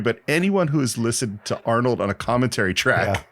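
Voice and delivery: normal talking voice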